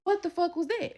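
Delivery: demanding an answer again; furious repeat. Retold or imitated. Repeating it, louder.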